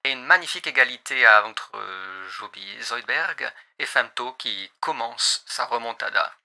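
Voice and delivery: pilot radio voice over headset; pilot cockpit headset. Acted. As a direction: speak like a cockpit announcement. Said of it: "la voix de pilote".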